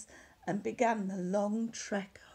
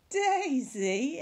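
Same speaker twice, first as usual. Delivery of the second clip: amused disbelief; 4 semitones higher, 5 dB louder than usual. Acted. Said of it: "everyone laughed".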